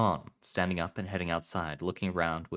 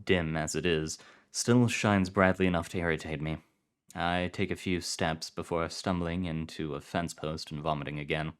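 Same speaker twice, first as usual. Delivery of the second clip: usual speaking voice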